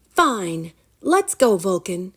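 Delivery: put-on high, sulky girl voice; reluctant girl. A narrator's reading. Girl Rory grudgingly leading the dragon away. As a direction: give in grudgingly.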